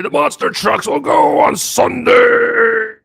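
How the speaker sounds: booming slow monster truck announcer. Retold or imitated. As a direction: hype it like a loud truck rally ad. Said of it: "Remember those stupid commercials".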